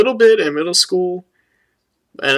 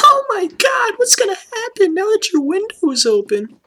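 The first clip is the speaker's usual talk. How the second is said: high squealing horror voice; mock panic. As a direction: shriek it like a horror movie.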